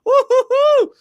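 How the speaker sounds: loud, very high excited whoop; hyped whoop. Real, not acted. Host hyping a viewer back in chat, loud high whoop. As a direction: whoop like the crowd just went wild.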